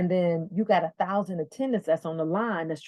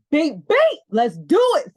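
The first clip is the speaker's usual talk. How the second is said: excited yes; 8 semitones higher, 9 dB louder than usual. Retold or imitated. Eagerly agreeing to the flight.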